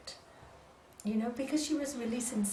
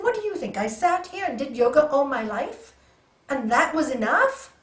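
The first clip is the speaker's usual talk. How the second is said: rude scolding; 2 semitones higher, 9 dB louder than usual. Retold or imitated. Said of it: "I was really quite rude to her".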